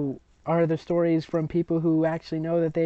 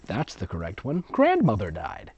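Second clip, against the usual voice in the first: usual speaking voice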